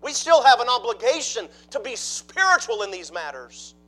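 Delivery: loud, high, driving sermon delivery; a preacher building to full force; full-force preaching. Acted. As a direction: preach it out to the back pews.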